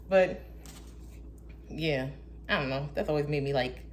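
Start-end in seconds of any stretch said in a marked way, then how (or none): none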